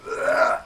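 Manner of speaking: imitated throaty retch; vomiting noise. Retelling her throwing up green stuff, mock heaving noise. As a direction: mimic someone throwing up. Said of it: "starts throwing up this green shit".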